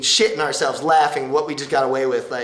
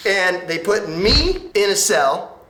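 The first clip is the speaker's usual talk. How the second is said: ordinary talk, nothing marked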